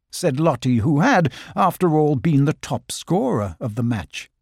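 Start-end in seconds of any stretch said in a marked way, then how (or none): none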